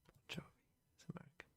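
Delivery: breathy whispered answers to self; hushed self-quizzing whisper. Real, not acted. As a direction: whisper it to yourself. Muttering answers under breath.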